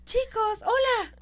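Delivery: high, slow startled greeting; Phoebe caught off guard. Retold or imitated. Friend imitating Phoebe's startled hello to the couple.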